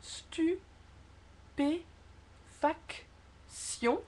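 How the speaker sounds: extremely slow "Stupéfaction", long pauses between stu, pé, fac, tion; syllable by syllable. Shown as a demonstration. Teacher starting the detailed explanation, syllable by syllable. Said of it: "syllabe par syllabe".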